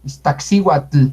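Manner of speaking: Iztaccíhuatl with a clearly released tl; Iztaccíhuatl ending in a full, voiced tl; audible tl. Shown as a demonstration. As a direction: sound out the final L in Iztaccíhuatl. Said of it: "Ahí se nota más ese tl".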